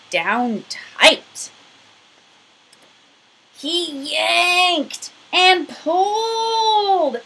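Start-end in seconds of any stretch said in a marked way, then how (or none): 3.6-7.2 higher, slow, strained as if pulling hard; straining effort. A narrator's reading. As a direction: heave the words out with effort. Bear strains at the button.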